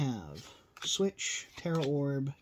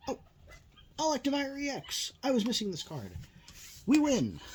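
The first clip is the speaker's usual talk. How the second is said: real thrill at a long-missing card, voice jumping much higher; excited; 12 semitones higher than usual. Real, not acted. Pulls the card he was missing.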